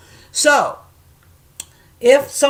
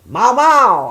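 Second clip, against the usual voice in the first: high, loud, stretched-out exclamation; showing off curses; 6 semitones higher, 7 dB louder than usual. Shown as a demonstration. Showing Marina she knows some slang, loud show-off. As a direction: belt it out playfully. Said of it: "I know some shit".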